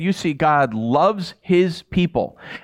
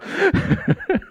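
laughing. Retold or imitated. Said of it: "so excited".

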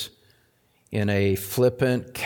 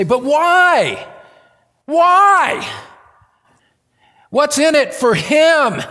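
much higher, louder pleading "why"; imagined questioner; 10 dB louder, slower than usual. Retold or imitated. Pastor voicing a probing questioner, high and loud. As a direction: cry out the doubter's question. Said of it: "probe just a little bit more and say".

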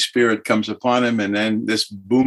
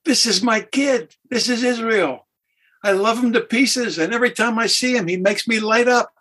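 high, beaming proud-dad voice, quick in the middle; God the Father; 11 semitones higher than usual. Retold or imitated. The Father looking at the listener, high and beaming. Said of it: "God the Father now looking at you going".

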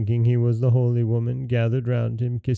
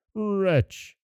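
genie's cry; 8 semitones higher than usual. Acted. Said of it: "he cried".

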